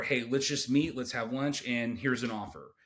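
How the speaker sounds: ordinary talk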